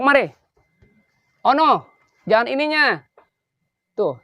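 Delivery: put-on fussy child ordering plates around; child's voice. Grandpa voicing the child asking for plates, pitched high.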